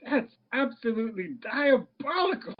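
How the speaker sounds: high, theatrical mock outrage; imitated co-host. Podcaster quoting the co-host's verdict, high mock outrage. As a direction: pitch it up like an appalled co-host. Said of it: "the co-host was just like".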